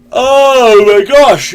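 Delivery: stretched out through a big yawn. Real, not acted. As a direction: say it mid-yawn, loud and slow. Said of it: "I'm yawning".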